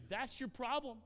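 high, loud, falling; loud retold insistence; emphatic insistence. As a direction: drive the point home. Pastor pressing his point.

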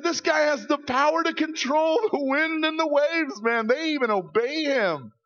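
high, loud astonished exclaiming; amazed disciples. Retold or imitated. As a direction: marvel out loud in disbelief. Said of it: "people are freaking out because they said".

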